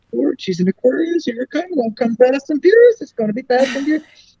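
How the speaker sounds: high-pitched, worked-up aside; mock alarm. Astrologer reacting to Aquarius client's new romance, mock alarm. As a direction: act out a gleeful oh-Lord warning.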